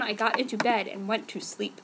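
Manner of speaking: ordinary talk, nothing marked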